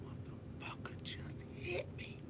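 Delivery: very soft, slow whisper of disbelief; stunned whisper. Retold or imitated. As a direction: whisper it slowly, as if in shock. Retold stunned realisation after being hit.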